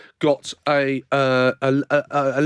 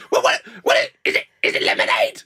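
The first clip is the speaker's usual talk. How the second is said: much higher, surprised voice of a startled woman; mock panic, pitched way up; shocked woman. Woman on the tube sprayed with water, high shocked voice.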